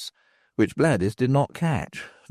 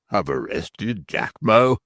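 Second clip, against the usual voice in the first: ordinary delivery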